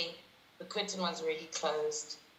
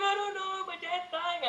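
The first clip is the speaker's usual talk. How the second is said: higher, strained voice imitating her own sobbing; retold tearful wail; 11 semitones higher, slower than usual. Telling friends on the road her dad is dying, tearful and high. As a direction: relive the panic, a touch of crying.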